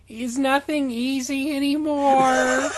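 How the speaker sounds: slow, high, exasperated whine; put upon. Acted. Reading Chris's line, slow, high and put-upon. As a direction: sigh it out like the world is against you. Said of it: "God, he's so put upon".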